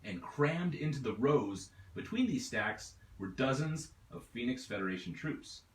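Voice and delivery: plain reading voice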